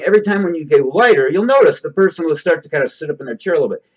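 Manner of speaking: ordinary delivery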